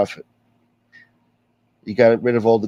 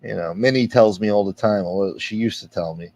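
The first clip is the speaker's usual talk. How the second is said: ordinary talk, nothing marked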